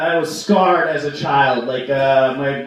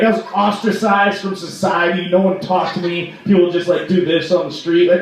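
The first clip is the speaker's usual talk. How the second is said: ordinary talk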